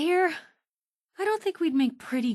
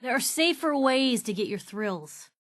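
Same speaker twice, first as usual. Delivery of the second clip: ordinary delivery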